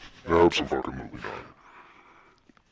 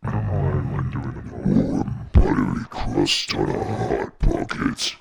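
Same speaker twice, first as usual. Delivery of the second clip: very low pitched, slow; deep voice effect; 6 semitones lower, 5 dB louder than usual. Shown as a demonstration. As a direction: drop the voice as low as it goes. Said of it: "how low my voice can go".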